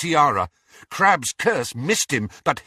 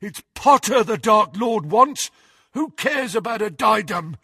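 high, truculent henchman; defiant argument; 8 semitones higher than usual. A narrator's reading. Crabbe argues with Malfoy about the diadem, defiant. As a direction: argue back, voice raised.

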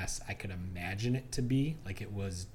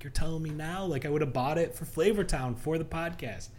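higher-pitched mock disbelief; exasperated; 7 semitones higher than usual. Traveler replying to partner's late mustard suggestion, exasperated. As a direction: protest that you're hearing it too late.

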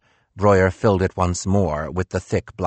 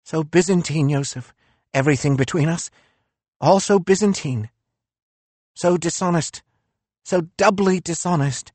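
higher, bitter philosopher voice; reproachful, raised-pitch Nietzsche; 10 semitones higher than usual. Acted.

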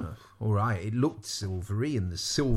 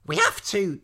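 urgent; 8 semitones higher, 4 dB louder than usual. A narrator's reading. Eliza urges a frightened Lavender.

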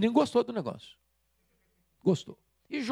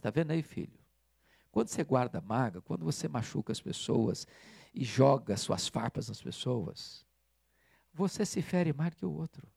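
gentle lesson; 5 semitones lower, 4 dB quieter than usual. Retold or imitated. Father's gentle lesson to son.

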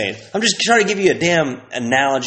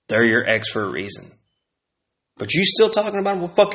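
ordinary talk

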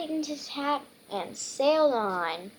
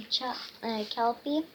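ordinary delivery

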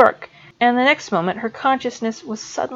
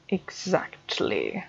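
much softer, slow clipped word; quiet Snape; 4 semitones lower, 11 dB quieter, much slower than usual. A narrator's reading. Professor steadies himself, quietly. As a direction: hold it down, calming himself.

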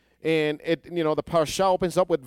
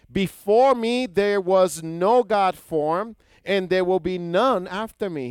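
emphatic scripture quote; 5 semitones higher, 5 dB louder, slower than usual. Acted. Preacher quoting God emphatically.

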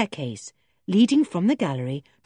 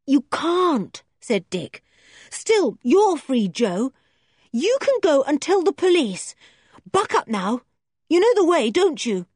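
put-on encouraging boy; 8 semitones higher, 4 dB louder than usual. Boy Dick voiced reassuringly.

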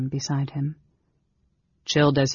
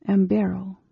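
higher, plainly voiced woman's answer; giving her name; 5 semitones higher than usual. A narrator's reading. Amberol answers the mage's question, higher voice.

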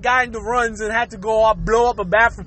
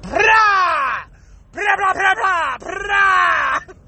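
higher, rattling burst of noise; imitated toilet blast; 5 semitones higher, much slower than usual. Comic acting out the bathroom disaster, imitated blast. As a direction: blurt it like an explosive bowel eruption. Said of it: "that thing is just gonna be like".

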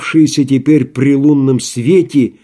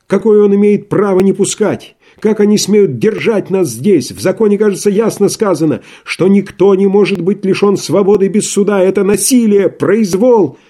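возмущение; 7 semitones higher, 4 dB louder than usual. Acted. Иван Дмитрич возмущается.

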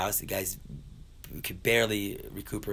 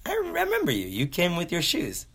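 hammed-up high-pitched surprise; amazed recognition; 9 semitones higher than usual. Seer amazed to see survivor.

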